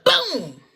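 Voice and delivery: loud, sudden vocal boom; punchy mock bang; imitated gunshot. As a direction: fire off a single explosive boom.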